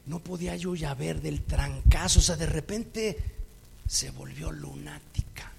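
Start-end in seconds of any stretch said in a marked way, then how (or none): 3.9-5.5 slow whisper of disbelief; hushed aside. Retold or imitated. The preacher whispers to the congregation that she went mad. Aside about her madness.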